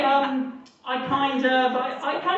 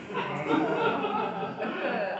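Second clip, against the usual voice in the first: chuckle; 6 dB quieter than usual. Real, not acted. Laughing at his own punchline.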